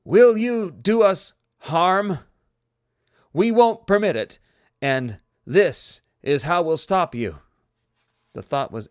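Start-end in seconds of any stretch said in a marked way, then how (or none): 0.0-7.4 booming, high and slow; loud commanding. Acted. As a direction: thunder the challenge. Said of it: "thought as loudly as he could".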